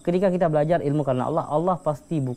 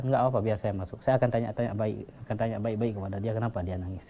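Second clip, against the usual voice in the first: softer, calm offer to check on him; gentle reassurance; 5 semitones lower, 7 dB quieter than usual. Retold or imitated. A worshipper calmly volunteers to ask Fudail gently. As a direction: quietly reassure the frightened crowd.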